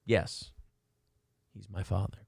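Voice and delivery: normal talking voice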